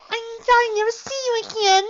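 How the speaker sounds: put-on high, loud tearful child's wail; crying little filly. A narrator's reading. Little sister crying while hugging her big sister, high and loud. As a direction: sob it out as a small child. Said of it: "little Sweet Belle cried".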